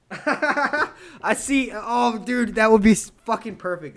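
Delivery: high, very loud delighted burst; loud, laughing outburst. Real, not acted. Streamer thrilled by a viewer's suggestion, laughing loudly. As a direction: crack up and blurt it out loudly. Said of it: "how loud my microphone just got".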